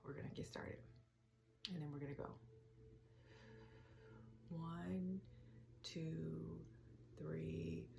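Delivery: soft, hushed meditation counting; guided breathing count. Acted. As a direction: count the breaths quietly, leaving room to inhale.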